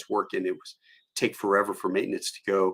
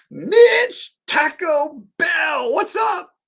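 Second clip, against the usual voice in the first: high, slow shouted greeting; calling out; 8 dB louder, much slower than usual. Retold or imitated. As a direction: hail an old buddy across the room. Old friend calling out greeting.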